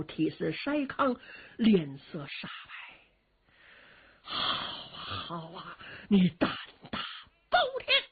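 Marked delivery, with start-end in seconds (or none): 4.2-8.0 furious emperor. A narrator's reading. Yang Guang shaking with rage at Cheng, furious. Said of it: "气得浑身力抖".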